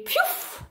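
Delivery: breathy "piouf" vanishing noise; whooshing poof. A narrator's reading. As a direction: make a magic whoosh of it. Vanishing sound effect in the story.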